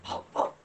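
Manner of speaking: short, soft bow-wow; dog bark. Shown as a demonstration. Teacher showing children how a dog sounds, soft short barks. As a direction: bark quietly in two short bursts. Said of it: "How does a dog sound?".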